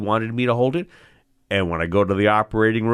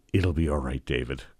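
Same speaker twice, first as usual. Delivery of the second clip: low, soft comforting voice; calm the panicking trainee gently; acted soothing reassurance; reassuring nurse; 7 semitones lower, 6 dB quieter than usual.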